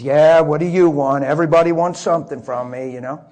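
mean forklift driver Mike barks back at the preacher; grumpy worker. Retold or imitated. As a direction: grumble like someone everyone pesters.

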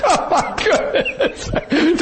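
high laughing oh my goodness; mock amazement, laughing; laughing exclamation. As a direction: laugh through a drawn-out oh my goodness. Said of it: "I'm like".

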